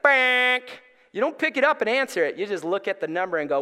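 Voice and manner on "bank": loud, high held buzzing noise; imitated pager buzz. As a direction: mimic a pager going off. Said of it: "It's like".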